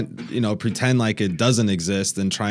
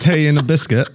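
mock British accent, pitched up; 6 semitones higher, 4 dB louder than usual. Guest fumbling the Australian task and going British, mock British accent. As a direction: attempt a British accent, higher voice. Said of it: "I'm gonna go British on you".

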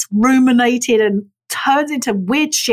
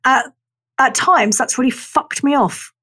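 ordinary delivery

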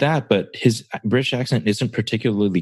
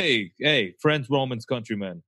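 higher, softer mock New York Italian; 4 semitones higher, 6 dB quieter than usual. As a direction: play Mark Antony as a New York Italian. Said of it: "like, New York Italian".

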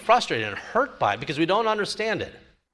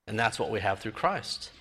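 normal talking voice

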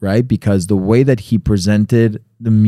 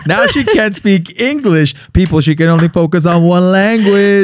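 teasing; 7 semitones higher than usual. Real, not acted. Teasing cohost to audience.

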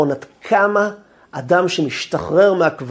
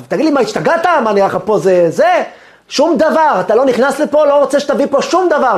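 raised, high-pitched scolding; angry yelling; 7 semitones higher, 3 dB louder than usual. Retold or imitated. Prison rabbi refusing the caller, angry yelling. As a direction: yell a furious refusal down the phone. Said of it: "הוא צועק עליו".

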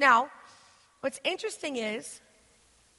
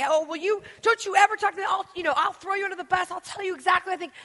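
higher, louder indignant rant; mock angry threat; 6 semitones higher, 4 dB louder, faster than usual. Preacher mimicking a power-hungry friend, higher angry rant. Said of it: "just power somebody".

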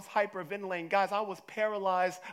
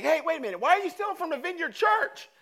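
high, louder mock scold of a thief; a raised, playful why are you stealing; 10 semitones higher, 7 dB louder than usual. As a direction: call out the thief, hammed up. Imagined conscience scolding a thief.

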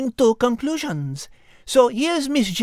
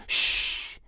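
hush; 7 dB quieter than usual. Acted. Liz hushing Al.

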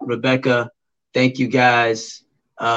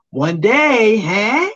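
hopeful excitement; 8 semitones higher, much slower than usual. Real, not acted. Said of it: "I'm believing".

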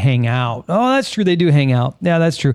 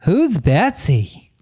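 put-on old person voice; 3 semitones higher than usual. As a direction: play a fussy old lady. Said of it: "using your old person voice".

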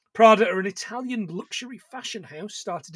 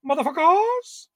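squeaky curse; 9 semitones higher than usual. Pretend. Repeated higher, odd voice.

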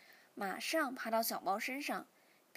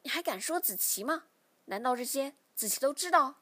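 raised, emphatic angry questions; glaring reproach; 3 semitones higher, 6 dB louder than usual. A narrator's reading. Father challenges son over his excuse, angrily reproachful. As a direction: challenge him, getting louder. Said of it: "父亲瞪着我说".

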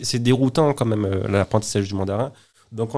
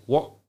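"wo" said as a short, separate syllable in the final tone; last tone demo; 4 semitones higher than usual. Shown as a demonstration. Teacher showing the last Mandarin tone, "wo" kept short. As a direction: set "wo" apart, shorter than the drawn-out tones. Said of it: "Et le dernier fait".

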